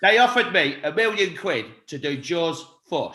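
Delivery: slow, deliberate Cockney film-star impression; Michael Caine. Shown as a demonstration. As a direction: drag it out in deadpan Cockney. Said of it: "random Michael Caine, Caine impression".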